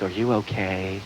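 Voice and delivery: hushed, low fussing over a victim; victim-coddling sympathy. Retold or imitated. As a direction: smother her with pity. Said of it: "they go right for that victim thing".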